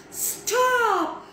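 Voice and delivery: sharp high-pitched shout; shouted command. A narrator's reading. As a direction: shout to halt it at once. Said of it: "he shouted".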